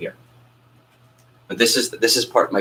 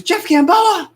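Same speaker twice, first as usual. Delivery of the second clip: high, loud delighted squeal of a name; overjoyed teacher recognizing him; excited greeting; 6 dB louder, much slower than usual. Retold or imitated. Choir director recognizing the caller, high excited squeal.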